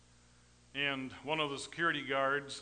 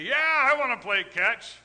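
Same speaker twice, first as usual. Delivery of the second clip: loud, high, eager cheer imitating kids; eager boys; 9 semitones higher, 9 dB louder than usual. Dad imitating his sons keen to play catch, eager shout. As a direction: imitate excited boys jumping at a game. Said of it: "all my boys would say".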